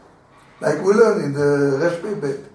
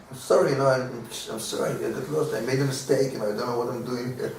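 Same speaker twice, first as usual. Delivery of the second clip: much softer, trailing sheepish sorry; soft apology; 5 semitones lower, 10 dB quieter, faster than usual. Retold or imitated. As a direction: apologize humbly, almost under your breath. Meek apology to angry neighbor.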